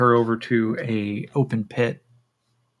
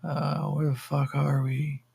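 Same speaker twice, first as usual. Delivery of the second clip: higher, dazed stoner voice; spaced-out, swooping pitch; Yakeem; 5 semitones higher, slower than usual. Acted. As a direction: play him stoned and bewildered. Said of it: "And Yakeem just goes".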